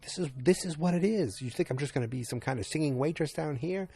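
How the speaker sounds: high-pitched retold outburst; acted-out scolding, high and heated; mock angry yell. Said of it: "she yells at him".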